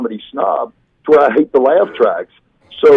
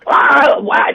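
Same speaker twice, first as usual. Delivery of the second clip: put-on high-pitched cry of a roused old wife; old woman. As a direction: imitate a startled, very old woman. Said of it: "And she goes".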